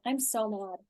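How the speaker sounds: seethe softly to yourself; understated acted venting; venting anger. Shown as a demonstration. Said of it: "And then I'll go".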